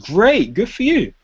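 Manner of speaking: high, slow, bright congratulation; be truly happy for him; genuinely pleased. Shown as a demonstration. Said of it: "Sound genuine".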